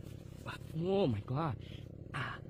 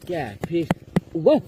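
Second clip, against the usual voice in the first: excited; 2 semitones higher, 12 dB louder than usual. Real, not acted. Big fish being landed.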